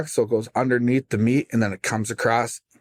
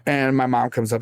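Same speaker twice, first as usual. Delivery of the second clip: ordinary talk, nothing marked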